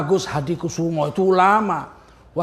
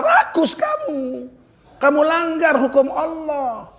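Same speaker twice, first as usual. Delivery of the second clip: slow scolding; 6 semitones higher, slower than usual. Acted. Driver scolds the greedy boss.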